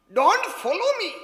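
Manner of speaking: high, loud, slow proclamation; proclaimed slowly. Acted. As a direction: ring it out slowly for the hall. Preacher proclaims the sign. (ऊँचा, ज़ोर से, धीमे-धीमे घोषणा)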